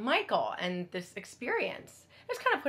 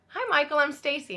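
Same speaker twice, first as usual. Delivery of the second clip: friendly introduction; 6 semitones higher, 4 dB louder than usual. Retold or imitated. Introduces herself in retold encounter.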